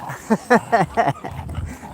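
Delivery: long breathy chuckle; amused laugh. Real, not acted. Walker joking about little ones' height, amused chuckle. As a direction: chuckle at your own remark.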